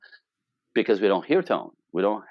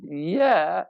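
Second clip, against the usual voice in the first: higher, drawn-out doubtful yes; hesitant squeak; 9 semitones higher than usual. Retold or imitated. Wife's yes that means no, hesitant squeak. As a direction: say yes with reluctance in the tone. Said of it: "the squeak".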